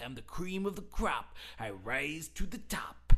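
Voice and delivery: Macho Man impression. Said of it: "He's like".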